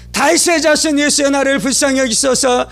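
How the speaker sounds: high-pitched repeated plea; urgent crying out. Retold or imitated. Blind Bartimaeus springs up calling to Jesus, crying out. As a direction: launch each appeal high, as if bursting out. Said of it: "그가 막 외치기 시작하는 것입니다".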